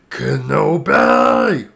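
drawn-out, rising loud villain cry; Darth Maul. Acted. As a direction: stretch the name into a vengeful call. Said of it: "that's my impersonation of the clip".

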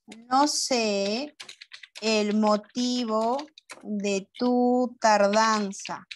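very slow, phrase by phrase with pauses; slow dictation. Shown as a demonstration. Teacher writes an example sentence, slow broken dictation. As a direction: dictate in pieces at writing speed.

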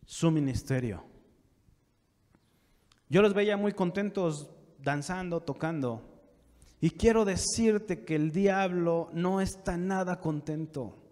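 usual speaking voice

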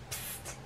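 soft hissed psst; a secret hiss for attention; whispered psst. Acted. Secretly calling Romeo.

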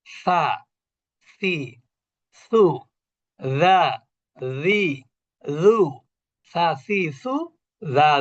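সা সি সু যা জি জু with the tongue tip at the teeth; tongue-tip letter drill. Shown as a demonstration. Teacher drilling students on tongue-tip letters. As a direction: drill each syllable crisply. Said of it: "জিহ্বার আগা উপরে দাঁতের আগা".

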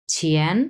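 ছিয়েন said with a rising Mandarin tone; rising second tone. Shown as a demonstration. Teacher repeats ছিয়েন for class, rising tone. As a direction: lift ছিয়েন upward at the end. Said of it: "তাহলে এটা হবে ছিয়েন".

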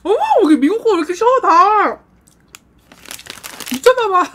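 높고 크게 놀라며 빠르게; 신맛에 놀라 터지는 감탄. Real, not acted. Sour candy shock reaction. (놀라서 크게 외치듯)

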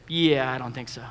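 dry refusal. Retold or imitated. Refusing God.

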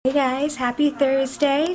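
usual speaking voice

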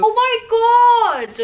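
real delighted oh my god; excited gasp. Real, not acted. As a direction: let the surprise burst out. Unboxing, excited by the surprise.

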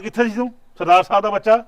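angry scolding. Acted. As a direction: tear into the lazy worker. Master scolding farmhand.

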